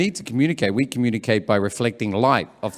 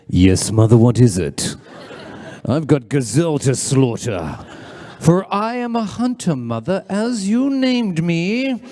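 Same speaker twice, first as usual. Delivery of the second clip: hunter son; slower than usual. Acted. Mighty hunter answering his mother, grandly declaimed. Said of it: "he better come running with a spear".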